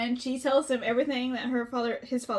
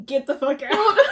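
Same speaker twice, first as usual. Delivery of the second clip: mock yell; 8 dB louder than usual. Acted yelling in locker room.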